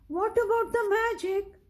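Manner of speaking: put-on high, worried little boy asking; anxious little boy. Kipper asks Biff about the magic, high worried child voice. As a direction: plead with a little boy's worry, voice up.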